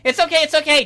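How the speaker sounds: loud hurried reassurance; calm her down, a bit loud. Acted. Gary quickly reassures the upset fairy, loud and hurried.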